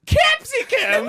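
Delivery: very high, loud, drawn-out disbelief; incredulous echo. Retold or imitated. Server hearing capsicum for the first time, incredulous. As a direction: shriek "capsicum" back as a baffled question. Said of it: "And he goes".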